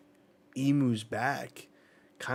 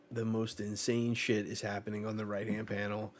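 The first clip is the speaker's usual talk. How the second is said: ordinary talk, nothing marked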